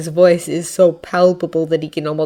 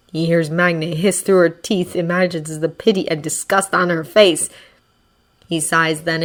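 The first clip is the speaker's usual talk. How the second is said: ordinary delivery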